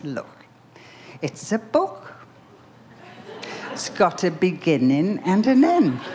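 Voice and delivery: imitated Liverpool street-kid accent. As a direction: explain it like a Liverpool lad discovering a book. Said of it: "and I'll use the accent".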